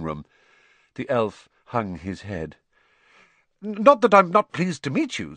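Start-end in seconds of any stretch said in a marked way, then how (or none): 3.6-5.4 hurried, stammered reassurance, pitched up; high, loud stumbling start; quickly. A narrator's reading. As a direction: blurt it out to smooth things over. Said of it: "said Harry quickly".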